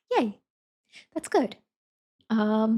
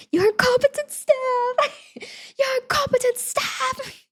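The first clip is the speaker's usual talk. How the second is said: very high, drawn-out screechy yell; hammed-up shriek in bad British accent; mock British yell; slower than usual. Shown as a demonstration. Telling viewer what to yell at teammates, mock British shriek. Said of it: "make sure you yell".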